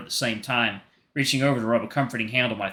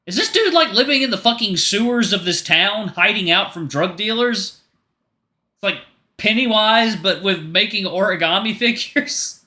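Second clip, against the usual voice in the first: loud amused outburst, pitched way up; incredulous reaction; 9 semitones higher, 6 dB louder than usual. Real, not acted. Reader breaking from the story to react, incredulous.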